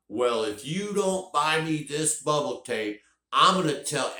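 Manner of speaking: high, slow tantrum yell at the top of his lungs; yelling kid. Retold or imitated. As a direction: scream it out like a furious child. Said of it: "at the top of his lungs".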